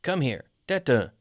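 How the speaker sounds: dog owner's falling commands; calling a dog. Shown as a demonstration. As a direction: call your dog over in short orders. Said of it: "like with your dog".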